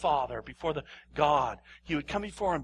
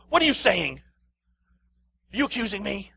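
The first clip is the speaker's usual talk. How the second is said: mock defensive, louder; 5 semitones higher, 6 dB louder than usual. Preacher imitating a defensive religious person, mock indignant. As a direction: bristle like an offended churchgoer. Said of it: "Start getting defensive".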